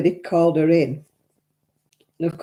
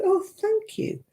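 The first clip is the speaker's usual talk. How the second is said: mock flattered; 4 dB quieter, slower than usual. Flattered reply to compliment.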